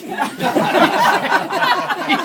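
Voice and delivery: helpless loud laughing fit; loud high laughter. Real, not acted. Teller laughing at his own shop story, loud and high.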